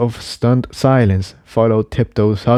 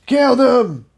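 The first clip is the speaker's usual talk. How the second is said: high, raised mob cry; angry shout; 13 semitones higher than usual. Acted. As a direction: yell from the crowd. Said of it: "shouted a stallion".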